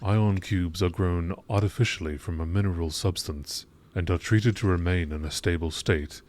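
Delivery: ordinary talk, nothing marked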